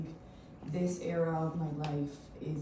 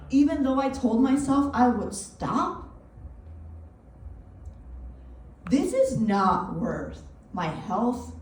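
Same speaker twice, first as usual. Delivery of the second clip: louder, higher, dramatic self-scolding; exasperated self-reproach; 6 semitones higher, 10 dB louder than usual. Acted. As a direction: berate yourself in a staged monologue. Berates self in performed monologue.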